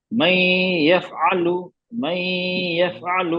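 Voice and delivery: "मैं" hummed long through the nose before "यफ़अलु"; nasal ghunna. Shown as a demonstration. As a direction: model recitation, stretching "मैं" nasally, then a clear "यफ़अलु". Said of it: "ये आवाज नाक से निकल रही है".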